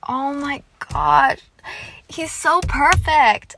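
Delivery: slow, high and loud, swooning over him; swooning gush. Retold or imitated. Fan reacting to her favorite character, slow swooning gush. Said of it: "I'm like".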